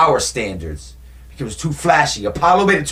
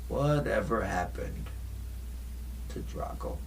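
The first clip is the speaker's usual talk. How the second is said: hushed, mysterious; 5 semitones lower, 14 dB quieter than usual. Shown as a demonstration. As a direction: let the question sink to a secret. Hushed dramatic repeat of question.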